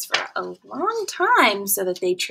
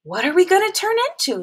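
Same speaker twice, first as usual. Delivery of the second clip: eager pollywog; 9 semitones higher, 4 dB louder, faster than usual. A narrator's reading.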